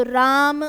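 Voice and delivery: राम held far past two beats, loud; drawn-out call. Shown as a demonstration. Teacher showing pluta by calling राम. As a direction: pull the आ in राम long like a call. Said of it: "दो मात्रा से ज़्यादा खींचो उसको".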